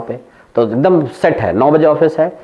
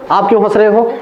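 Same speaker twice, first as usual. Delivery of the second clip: raised pitch, mock scolding auntie; teacher imitating an auntie asking why he laughs, higher, mock scolding; auntie's question; 8 semitones higher, faster than usual. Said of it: "एक aunty कह रही हैं".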